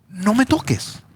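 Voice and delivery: high, lightly voiced Jesus; a hint of Jesus telling her to let go; Jesus to Mary, lightly voiced; gentle command.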